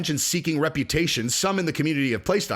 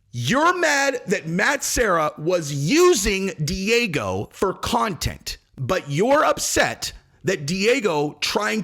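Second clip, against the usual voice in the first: slow, higher condescending summary; slow sarcastic; 3 semitones higher, slower than usual. Acted. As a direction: lay it out slowly, mockingly.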